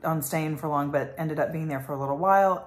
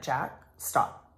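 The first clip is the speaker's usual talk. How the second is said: calm coaching; 2 semitones lower, 6 dB quieter than usual. Retold or imitated. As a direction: settle him down gently. Mom calming frustrated child.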